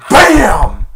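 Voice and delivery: loud, high "bam"; explosive punch noise. Retold or imitated. Arnold punching Santa in the face, explosive imitation. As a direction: burst out the impact.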